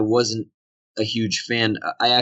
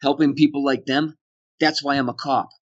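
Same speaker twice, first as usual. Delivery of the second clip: cop with a Boston O, pitched up; Boston accent; 4 semitones higher than usual. Retold or imitated. Actor retelling his scripted line with a Boston cop. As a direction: let the Boston slip into cop. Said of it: "what was scripted was this".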